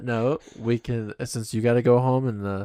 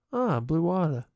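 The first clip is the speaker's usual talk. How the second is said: pitched up high, mock bewildered; mock puzzled bathroom guy; 7 semitones higher than usual. Guy in a public restroom, puzzled by the blue water, high mock bewilderment. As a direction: play a guy baffled at fresh blue water.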